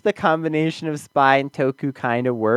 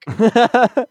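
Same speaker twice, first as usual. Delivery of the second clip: sudden loud laugh, higher; loud burst of laughter; 8 semitones higher, 6 dB louder than usual. Real, not acted. Co-host laughing hard at the joke, loud burst of laughter.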